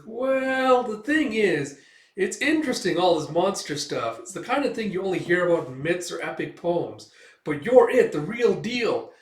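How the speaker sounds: high, excited fan's voice; eager little sidekick. Retold or imitated. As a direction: gush in a perky little character voice.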